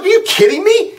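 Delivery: retold outburst, voice leaping up in pitch; outraged disbelief. Man arguing with airline agent over company policy, outraged disbelief.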